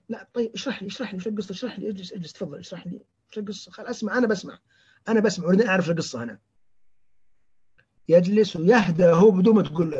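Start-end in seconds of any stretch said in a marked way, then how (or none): 0.1-6.4 hushed, gentle coaxing; soft calming. Shown as a demonstration. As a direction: softly soothe an agitated patient and offer to listen. تهدئة مريض يصرخ بأسلوب لطيف. (تهدئة لطيفة بصوت خافت)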